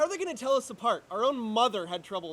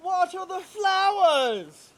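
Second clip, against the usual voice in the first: high, slow, loud sneering boss impression; mocking his boss; 9 semitones higher, 7 dB louder, much slower than usual. Albert mocking his boss's order to his twin, high and slow. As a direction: drag it out like a pompous boss giving orders. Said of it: "He makes a feeble attempt to mock his boss".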